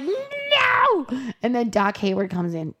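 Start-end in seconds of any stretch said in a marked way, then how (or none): 0.0-1.1 very high, stretched mock no; flat refusal. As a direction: squeal out a defiant no. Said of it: "she's like".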